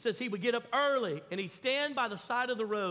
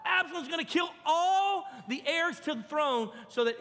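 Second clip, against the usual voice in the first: panicked blurt; 6 semitones higher, 4 dB louder than usual. Acted. Brothers fleeing in panic.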